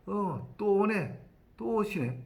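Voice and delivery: slow, drawn-out high "또 오네"; a weary cop who has seen this before; knowing sigh. Retold or imitated. Policeman greets repeat victim. (또 왔냐는 듯 느릿하게 말하는 경찰관)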